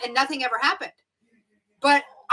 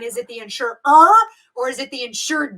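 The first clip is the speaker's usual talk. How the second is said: "insurer" and "insured" each given a deliberate, slightly silly lean on the ending; over-stress the R of "insurer" and the D of "insured"; emphasis on insurer and insured; 7 dB louder than usual. Shown as a demonstration. Teacher showing students how to read the key word, leaning on endings. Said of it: "if you say it with an emphasis".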